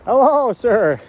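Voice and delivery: loud, high greeting to someone away; calling out. Real, not acted. Host to a passing man, calling out high and loud.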